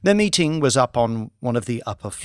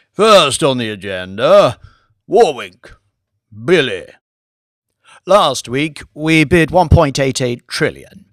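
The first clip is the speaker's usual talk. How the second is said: a louder, commanding lordly voice; Lord Martin; 6 dB louder than usual. Acted. Lord briefing his bankers on the Warwink bid, commanding. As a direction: run the meeting like a peer who owns the room.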